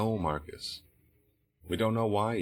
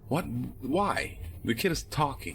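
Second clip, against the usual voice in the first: indignant surprise; 8 semitones higher than usual. Acted. Detective pulled off interrogation.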